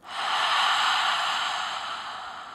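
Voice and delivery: long breathy haa through open mouth; open-mouth fogging exhale. Shown as a demonstration. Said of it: "exhale out your mouth like you're fogging a mirror".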